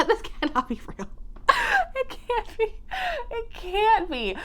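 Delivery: high, squeaky words through laughter; cracking up. Real, not acted. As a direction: laugh helplessly through the disbelief. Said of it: "it has me cracking up".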